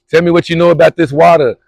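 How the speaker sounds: high and loud, pumped up signing off; hyped sign-off. Real, not acted. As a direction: shout out to the viewers full of energy.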